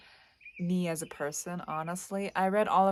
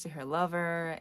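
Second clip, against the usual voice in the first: ordinary talk, nothing marked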